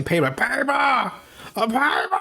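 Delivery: high-pitched, badly faked creepy villain; half-successful creeper imitation in a high voice. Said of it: "I can't even do the voice right".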